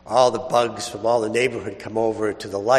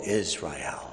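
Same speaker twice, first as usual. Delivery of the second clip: solemn Gospel reading. Acted. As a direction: read scripture with hushed reverence. Solemn Gospel reading, held style.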